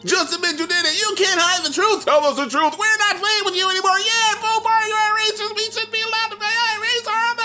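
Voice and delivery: put-on high shrill kids shouting; little kid voices. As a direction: mob of kids yelling, very high. Classmates yelling accusations at him.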